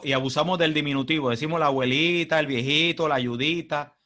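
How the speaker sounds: ordinary talk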